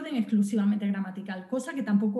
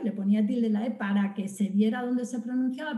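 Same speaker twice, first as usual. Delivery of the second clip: normal talking voice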